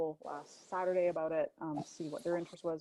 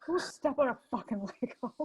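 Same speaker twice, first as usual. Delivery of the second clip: playful insult; 7 semitones higher, 4 dB louder than usual. Acted.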